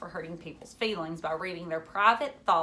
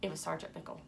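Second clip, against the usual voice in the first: usual speaking voice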